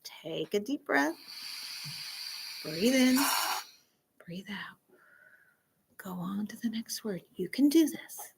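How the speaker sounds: soft, calming guide; peaceful voice. Pretend. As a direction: breathe them down to calm, fading to a whisper. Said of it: "And then peaceful says".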